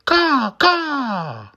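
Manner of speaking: very high raven caw; raven cry. Acted. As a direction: shriek it like a raven. Becomes raven, cries out.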